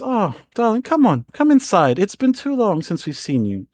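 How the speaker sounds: acted motherly fussing; welcoming mother. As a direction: usher your darling child indoors. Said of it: "and goes".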